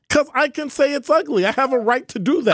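high-pitched, defensive insistence; indignant protest. Real, not acted. Co-host defends his right to call the fashion ugly, indignant.

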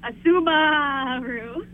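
slow sheep-like bleat on the "ba" of "Subaru"; sheep bleat punchline. Acted. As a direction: stretch "Subaru" into "Su-baaa-ru" like a sheep. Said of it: "Wait, do that again. Do that again".

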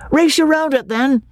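high-pitched playful challenge; excited cry. A narrator's reading. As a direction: call it out high to friends in a race. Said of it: "cried Freddy".

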